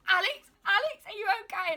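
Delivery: high, loud imitation of a panicked mum; worried mum. Mum on the phone hearing her daughter choke, high panicked call. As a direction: squeal out her name like a frightened mum.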